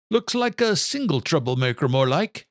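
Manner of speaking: lightly voiced Irish father, dry; Irish brogue, amused correction; a hint of an Irish father. Father's voice in story.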